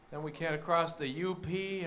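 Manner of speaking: ordinary talk, nothing marked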